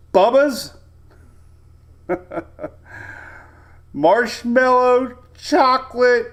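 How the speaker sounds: high, loud amused reading of the name; amused exclaim. Real, not acted. Meteorologist reading a funny cocktail name on stream, amused and loud.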